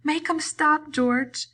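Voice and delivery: high, loud bleating plea; terrified cry. A narrator's reading. Lenny begging George to stop the beating, terrified. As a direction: cry out in panic. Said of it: "He cried".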